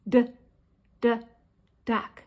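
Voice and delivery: short separate "d, d", then "duck"; sounded-out D. Shown as a demonstration. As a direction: tap the D alone before "duck". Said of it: "D sound".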